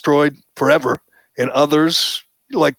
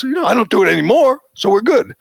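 higher, fast, tossed-off dismissive tone; mock breezy; 8 semitones higher, faster than usual. Host mocking Damon's shrug about the slur, breezy. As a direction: rattle it off as if it's no big deal. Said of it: "laughs it off and says".

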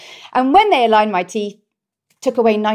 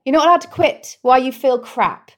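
usual speaking voice

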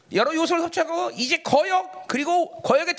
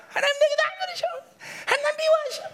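high-pitched whine of grievance; mock complaining; 12 semitones higher than usual. Resentful believer complaining that God ignores them, mock whining. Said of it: "원망이 들어오니까 그냥 금세".

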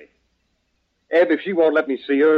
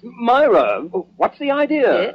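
high-pitched alarm; object, taken aback; pitched-up flustered exclamation; startled protest; 8 semitones higher, slower than usual. Acted. Startled by her jumping on lap.

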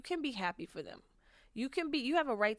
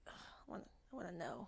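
soft, much quieter longing whisper; yearning whisper; 14 dB quieter, much faster than usual. Retold or imitated. Describing a crush's pull, hushed longing whisper. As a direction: breathe it like you can't help wanting. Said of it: "you just wanna like".

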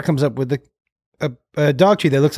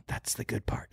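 conspiratorial whisper back; 7 semitones lower, 18 dB quieter than usual. Retold or imitated. Parent lets son in on the prank, whispering. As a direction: whisper it like a shared secret.

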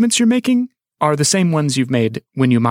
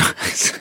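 stifled laugh; 5 dB quieter than usual. Real, not acted. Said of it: "without laughing".

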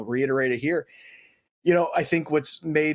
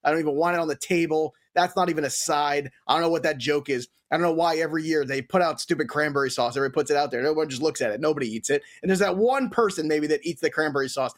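ordinary talk, nothing marked

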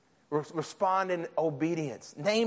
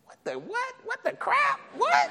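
squeaky falsetto shock, much higher; a pitched-up comic what?!; shocked disbelief. Retold or imitated. Preacher mock-reacting to calling his father, falsetto shock. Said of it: "shocked reaction to God's request".

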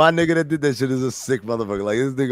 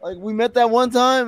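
mock woman's voice; 10 semitones higher, 3 dB louder than usual. Woman placing a stranger.

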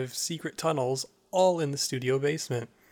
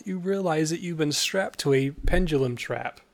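usual speaking voice

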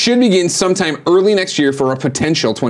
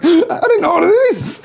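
high, whimpering excuse through laughter; hammed-up helpless whine; mock panicked excuse. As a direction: plead innocence in a squeaky voice. Acts out pleading excuse to the chef.

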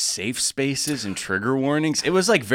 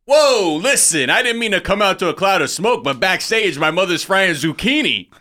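put-on Jersey guy accent; 5 semitones higher than usual. Comedian reading the host's opening monologue in Jersey accent. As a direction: ham up a New Jersey host. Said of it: "I don't know why I'm doing this accent".

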